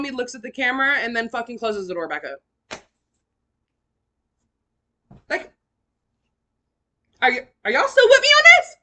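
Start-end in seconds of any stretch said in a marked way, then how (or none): 5.3-8.8 very high, loud incredulous check-in; exasperated calling out. Real, not acted. Storyteller checking her listeners still follow, loud high exasperation. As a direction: call out to the audience in disbelief.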